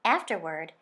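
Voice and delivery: ordinary delivery, nothing marked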